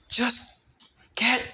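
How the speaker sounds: high, loud order; urgent yell. Acted. Leon orders Alexa away, high urgent yell. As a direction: bark the order to move now.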